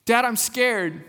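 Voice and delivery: a hint of a scared daughter; scared child. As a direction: say it like a frightened little girl. Daughter tells dad she's scared.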